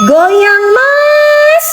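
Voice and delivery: a flirty falsetto cry; coy falsetto squeal; squeaky teasing call. Acted. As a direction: jump way up high and squeal it playfully.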